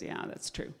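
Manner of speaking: whispered, small agreement; the cat agrees with the fox in a whisper; meek whisper. Acted. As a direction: give in under your breath.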